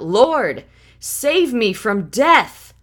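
loud, high cry for rescue; hammed-up desperate wail; dramatic plea. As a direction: cry out like a sinking man. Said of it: "Aren't we dramatic?".

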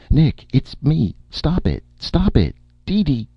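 high-pitched, loud pleading cries; urgent calling. A narrator's reading. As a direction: call out to stop them, voice leaping high. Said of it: "Erika's voice called".

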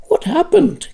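high startled question; alarmed cry. A narrator's reading. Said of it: "cried Lanark".

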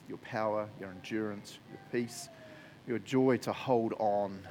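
soft, unhurried prayer voice; heartfelt prayer. Real, not acted. Pastor praying for struggling listeners, soft and earnest. Pastor prays over the congregation.

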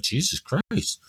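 ordinary talk

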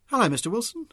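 surprised hello, lifted well up in pitch; surprised greeting. A narrator's reading. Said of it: "looked surprised".

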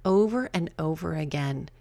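ordinary talk, nothing marked

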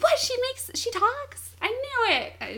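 very high-pitched mock shock; startled, voice shooting way up; astonished. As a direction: imitate a girl squeaking in amazement. Acted girl shocked doll talks.

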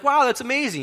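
high, loud acted wow; imitating thrilled, astonished customers; amazed delight. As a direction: react as if handed a huge bargain. Debtors react to a discount.

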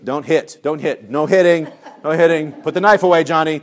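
loud mock orders to kids; harried scolding. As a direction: call out warnings over the chaos.

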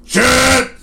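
high, loud heckler's shout; sudden imitated interruption from the crowd; Nolan. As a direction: yell it over the singer's intro. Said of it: "Nolan goes".

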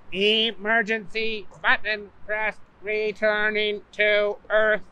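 slow robotic announcement; ship computer voice. Acted. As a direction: intercom announcement, slow and even. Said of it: "over the intercom came the fateful words".

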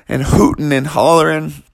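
usual speaking voice

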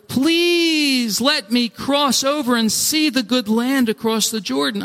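high, long-drawn begging; pleading. Acted. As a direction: stretch the please into a desperate plea. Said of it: "I pleaded with the Lord".

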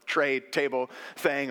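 ordinary talk

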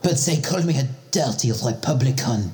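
a Frenchman sulking about insults; comic French accent. Acted. Same French-accented character.

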